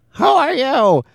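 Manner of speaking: squeaky cartoon pappy. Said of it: "he goes".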